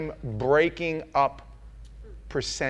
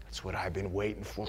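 soft, hushed, loving acceptance; God welcoming the broken man, soft and low; quiet tender welcome; 7 semitones lower, 10 dB quieter, faster than usual. Retold or imitated. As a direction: draw him in gently. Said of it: "what he said to me".

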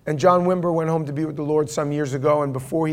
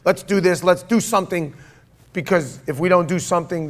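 louder, higher, urgent insistence; rushing ahead; 3 semitones higher, 4 dB louder than usual. Acted. Peter urging the others to do something, impulsive and insistent. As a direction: push them to act at once, pitched up and loud. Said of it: "speaking out of turn".

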